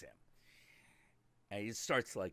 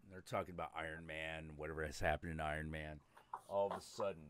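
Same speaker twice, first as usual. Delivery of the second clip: ordinary delivery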